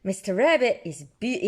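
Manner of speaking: ordinary delivery, nothing marked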